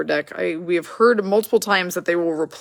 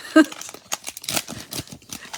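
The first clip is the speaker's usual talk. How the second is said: silent wheezing laugh; breathless laugh. Real, not acted. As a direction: laugh helplessly at your own slip. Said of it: "I can't stop laughing about".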